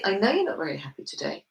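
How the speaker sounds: low, quick, matter-of-fact certainty; confident. Shown as a demonstration. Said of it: "that's my level of confidence".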